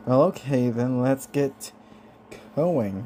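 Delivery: ordinary delivery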